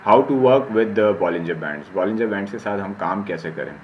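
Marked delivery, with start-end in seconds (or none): 0.1-2.0 slow, careful read-out of an English heading; reading a slide aloud. Shown as a demonstration. Teacher slowly reading the slide heading to his students. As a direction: spell out each word slowly for students. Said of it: "मैं आपको पढ़ के बता देता हूँ".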